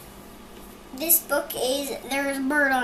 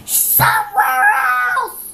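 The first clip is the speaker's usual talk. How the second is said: very high, loud, slow yell; mock outburst; 11 dB louder, slower than usual. Child retelling Elephant yelling where to put the birds.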